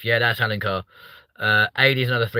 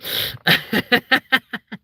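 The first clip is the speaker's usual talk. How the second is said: high rising laugh; 12 semitones higher than usual. Real, not acted. Streamer laughing at Cameron's reply in chat, high and rising. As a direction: let a high laugh rise out.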